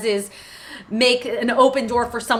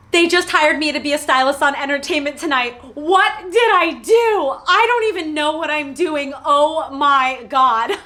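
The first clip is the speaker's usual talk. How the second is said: freaking out; 5 semitones higher than usual. Retold or imitated. New hire phoning parents, high freaked-out voice. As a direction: re-enact an overwhelmed call, slowing at the end. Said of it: "I freaked out".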